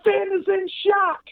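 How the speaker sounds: high, drawn-out mock astonishment; hammed-up shocked announcer; overdramatic commentator. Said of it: "overdramatic, which was the point".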